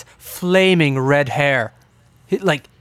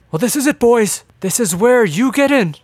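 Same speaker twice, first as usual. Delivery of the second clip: higher, animated mad-scientist voice; excited eccentric inventor; 7 semitones higher, 4 dB louder than usual. Acted. Inventor shows the boys the portal, excited mad-scientist voice. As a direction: present the big invention with flair. Game master voices Doc.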